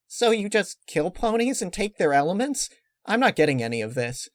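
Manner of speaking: put-on high pony girl, baffled; Pinkie Pie voice. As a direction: pitch up and rattle it off puzzled.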